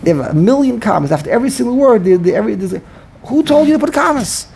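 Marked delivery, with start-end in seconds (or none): 3.2-4.5 mock indignant question. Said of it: "He asked them".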